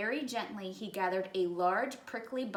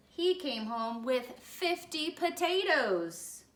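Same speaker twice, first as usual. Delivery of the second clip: punchline; 5 semitones higher, 3 dB louder, slower than usual. A narrator's reading. Buddy's mix-up punchline.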